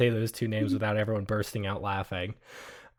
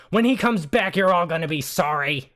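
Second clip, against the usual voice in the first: very high, loud parting threat; 6 semitones higher, 6 dB louder than usual. Acted. Woman yelling a threat while dragged out, high and loud. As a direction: yell the warning on the way out. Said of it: "she says".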